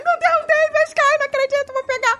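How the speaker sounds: gritinho muito agudo de incredulidade; euforia encenada. Retold or imitated. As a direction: reencena a euforia num fio de voz agudíssimo. Revive ver a Sky disponível. (eufórica, voz bem fininha)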